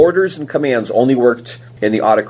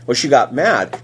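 normal talking voice

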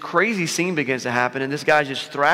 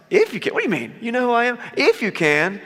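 sarcastic; 6 semitones higher than usual. Retold or imitated. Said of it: "said it with a little bit of, like, sarcasm".

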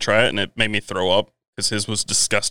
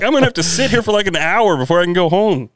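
much higher, mock overwhelmed complaint; tipsy exasperation; 7 semitones higher than usual. Retelling the too-strong Portland coffee, a high mock complaint. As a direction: squeal it like the drink just flattened you. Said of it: "I'm like".

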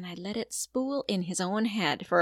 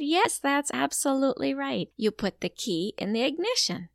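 excited praise; 6 semitones higher, 4 dB louder than usual. Shown as a demonstration. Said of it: "praising the child".

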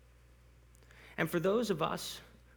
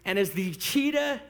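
hammed-up victorious finish, stretching the words higher and higher; triumphant climax; 8 semitones higher, 4 dB louder, slower than usual. Preacher crowning the gazelle's escape, mock-epic triumph. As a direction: build from raised voice to high, loud, drawn-out cry. Climax of the acted chase story.

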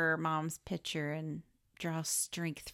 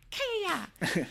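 very high, squealed karate cry; mock battle cry; 12 semitones higher than usual. Podcaster mimicking the stabbing fight, high mock battle cry. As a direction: let out a hammed-up attack squeal. Imitates the choreographed stabbing attack.

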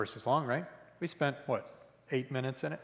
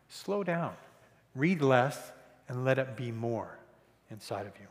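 usual speaking voice

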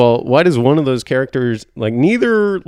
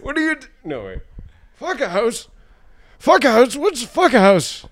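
put-on very old man; 13 semitones higher than usual. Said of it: "I forgot how to do an old man voice".

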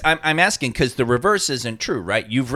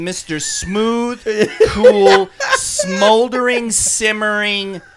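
slow, higher, stretched-out words; drawn-out smoulder; 5 semitones higher, 3 dB louder, much slower than usual. Acted. Describing Olmos's usual screen persona, slow and drawn out. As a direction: linger on each adjective.

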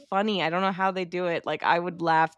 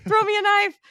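high, louder mock plea; imitating Jay again; 6 dB louder than usual. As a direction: call it out pitched way up. Repeating the quote, louder.

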